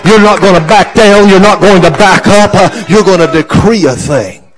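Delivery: loud, high prophetic preaching; full-force preaching. Real, not acted. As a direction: preach it hard and loud, voice pushed up.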